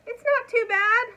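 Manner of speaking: very high, very loud shriek. A narrator's reading. Dragon shrieking an answer to Elmer, very high and loud. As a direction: shriek it over the storm. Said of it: "shrieked the dragon".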